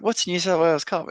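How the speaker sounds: baffled question. Retold or imitated. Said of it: "And I was like".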